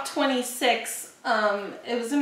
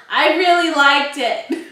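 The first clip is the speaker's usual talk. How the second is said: louder, drawn-out enthusiasm; speaking up; 4 semitones higher, 6 dB louder, slower than usual. Real, not acted. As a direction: push the volume up, slowly. Speaking up as asked.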